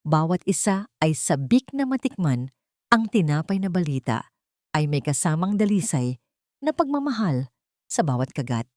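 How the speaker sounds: usual speaking voice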